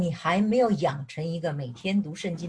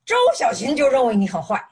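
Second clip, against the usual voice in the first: loud, raised mock accusation from the husband; husband's angry retort; 6 semitones higher, 10 dB louder than usual. Husband to wife in a quarrel, loud accusing retort. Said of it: "丈夫就说".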